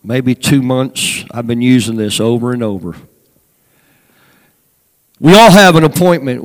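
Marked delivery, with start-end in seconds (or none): 5.2-6.4 loud preaching. Acted. Preacher at full force.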